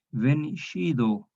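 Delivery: "vencido" with the c as a sh sound, veni-shi-do; "vencido" spoken slowly, c like English sh; old Spanish sound. Shown as a demonstration. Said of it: "para pronunciarlo como J antigua".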